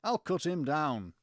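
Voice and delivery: slow, lilting mock Liverpool [name]; generic [name] accent. Saint George vows to fight the dragon, generic [name] accent. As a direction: declaim the rhyme as a stock [name], Liverpool accent. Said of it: "generic [name]".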